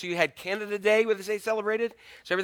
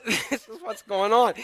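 ordinary talk

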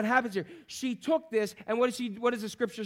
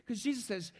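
ordinary delivery

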